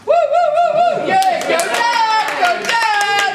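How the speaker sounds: loud excited cheering. Real, not acted. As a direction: whoop and cheer for Dan. Cheering the best man.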